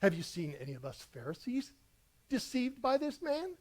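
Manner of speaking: mock haughty question climbing high at the end; highfalutin Pharisees. Preacher mocking the Pharisees' lofty question to his congregation. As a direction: imitate snooty religious leaders talking down. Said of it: "This was like their highfalutin".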